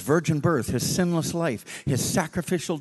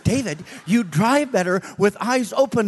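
louder urgent plea; 6 semitones higher, 4 dB louder than usual. Retold or imitated. Preacher urging the driver to watch the road, louder. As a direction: push him to open his eyes.

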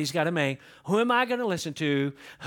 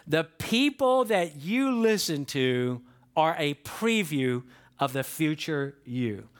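slower repeat; 3 semitones lower, slower than usual. Shown as a demonstration. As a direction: say it again, slower, for memory. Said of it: "Let me say that again".

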